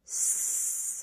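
"S-E" split into its two sounds, hissed s then e; stretched sounds. Shown as a demonstration. Teacher stretches see into separate sounds. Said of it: "Let's do that again".

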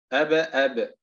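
أب، أب with a strong echo on the b; أب، أب with a full qalqala release; stronger qalqala. Shown as a demonstration. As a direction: bounce the final ب of أب hard, twice. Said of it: "التمييز بين مراتب القلقلة".

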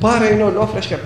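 loud, high retold seller's call; insistent hawking. Fisherman presses passer-by to buy.

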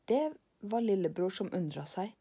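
ordinary delivery, nothing marked

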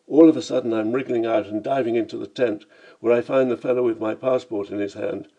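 plain reading voice

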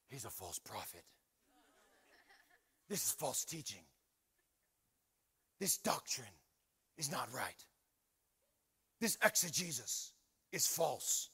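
low, hushed, solemn pronouncement; pompous commenter, softly passing judgment; mock online critic. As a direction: intone each verdict low and quiet, with pauses. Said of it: "I go on his comment section".

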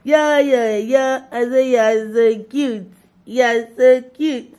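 slow, high, loud cooing. Real, not acted. Cooing at a baby.

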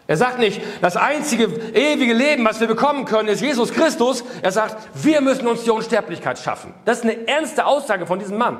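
fervent sermon delivery; impassioned preaching. Acted. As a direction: preach it hard with rising fervour. Preaching at full force.

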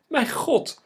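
very high, loud shriek of despair; desperate scream. A narrator's reading. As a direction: scream it out, utterly frantic. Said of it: "gilde Eline radeloos". (radeloos gillen)

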